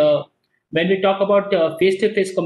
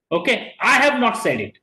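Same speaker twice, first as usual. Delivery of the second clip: ordinary talk, nothing marked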